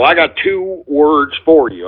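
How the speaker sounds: ordinary talk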